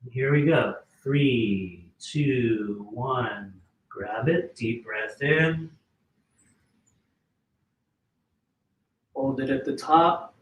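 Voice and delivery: slow, calm guided breathing cues; freediver breathing. Acted. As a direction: count them down calmly, then breathe. Leads a breathing exercise.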